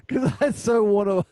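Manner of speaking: loud, high, rising laughing delight; gleeful teasing. Real, not acted. Co-host wanting video of his friend drinking pond water, loud gleeful teasing. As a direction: crow it while cracking up.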